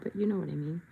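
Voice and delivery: normal talking voice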